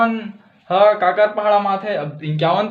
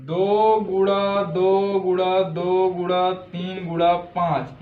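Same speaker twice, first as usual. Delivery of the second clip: slow, spaced-out dictation of the factors; dictating pace for copying; slower than usual. Shown as a demonstration. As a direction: spell out each factor slowly for notes.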